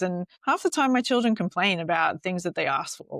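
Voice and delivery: normal talking voice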